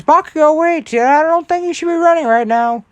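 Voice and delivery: very high-pitched character voice calling out; Recovery Girl. Acted. Healer calls after runaway patient, high character voice. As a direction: call after them in a high put-on voice.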